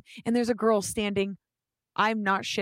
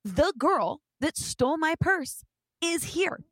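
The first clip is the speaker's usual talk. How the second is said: worked-up phone rant, pitched high; heated; 4 semitones higher than usual. Retold or imitated. Girl on the phone about her stolen purse, heated. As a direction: act out an indignant phone call. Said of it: "in a heated conversation".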